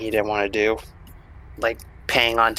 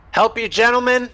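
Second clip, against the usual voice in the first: hostile bark, much higher and louder; aggressive cop; 13 semitones higher, 5 dB louder than usual. Acted. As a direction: snap it at them like a suspicious cop. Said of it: "he's aggressively, no one has more aggressively said".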